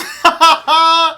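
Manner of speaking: loud burst of laughter. Real, not acted. Laughing at her look.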